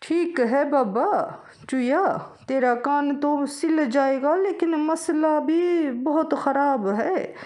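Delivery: high, loud, slow village farmer. Acted. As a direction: draw out each word like an old farmer. Farmer voiced in story.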